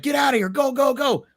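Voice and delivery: high frantic urging; panicked urging. Retold or imitated. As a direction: hurry them, terrified. Fleeing in panic in car.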